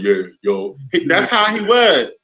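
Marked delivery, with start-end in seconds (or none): 0.0-0.8 much lower, cool casual greeting; echoing his low voice to the listeners; deep guy voice. Retold or imitated. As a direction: play it deep and tough. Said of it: "I was like".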